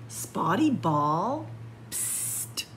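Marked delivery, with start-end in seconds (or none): 1.9-2.6 soft hissed psst; whispered psst. Acted. Reading to kids, the chameleon softly psst at a ball. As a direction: get its attention with a hush.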